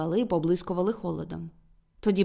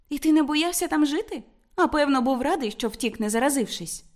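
higher-pitched voice for an inquisitive woman; curious young woman; 6 semitones higher than usual. A narrator's reading. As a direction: voice her questioning him, pitched up. Narrator voices Ruth questioning Martin.

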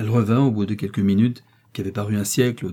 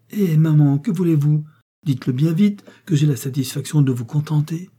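high, louder burst of eagerness; eager urging; 6 semitones higher, 4 dB louder than usual. A narrator's reading. Daughter pressing her mother to name a wish, eager. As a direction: urge her brightly to speak up.

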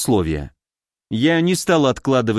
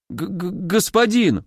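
higher, drawn-out stutter on the first sound; frightened stammer; 5 semitones higher than usual. A narrator's reading. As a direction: exclaim like a startled servant, stammering. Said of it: "воскликнул испуганный парень". (испуганно, заикаясь)

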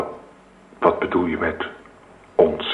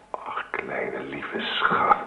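soft, slow loving whisper; husband whispering tenderly to his pregnant wife; 6 semitones lower, 7 dB quieter, much slower than usual. Acted.

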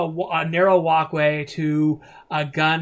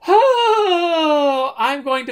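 much higher, louder theatrical wail; overdone swooning distress; hammed-up faint; 11 semitones higher, 9 dB louder, much slower than usual. GM voices Adelaide faking a faint, hammed-up swoon. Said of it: "as you go".

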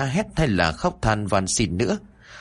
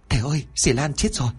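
higher, hurried call; a little girl crying out the bad news; girl calls the master that aunt Lan is dead, urgent; frightened girl; 5 semitones higher, faster than usual.

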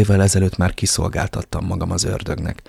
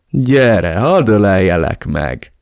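feigned surprise; 5 dB louder, slower than usual. Acted. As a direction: put on a show of joy. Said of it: "játszotta a meglepettet". (színlelt meglepetéssel, harsányan)

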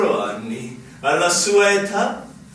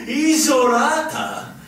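amused disbelief at a naive answer; mocking laugh; 8 semitones higher, slower than usual. General scoffs at the lieutenant, laughing.

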